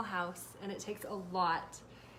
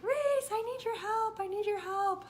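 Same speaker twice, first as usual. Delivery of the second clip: much higher, louder call, slowed; calling for help; 12 semitones higher, 5 dB louder than usual. Retold or imitated. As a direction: call across the house for your son. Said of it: "and I am like".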